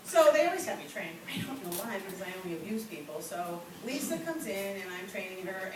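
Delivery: ordinary talk, nothing marked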